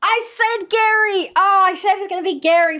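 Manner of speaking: high, loud triumphant yell; shouting the name in delight; excited shout. Real, not acted. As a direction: crow that you called it. Guess proved right, excited.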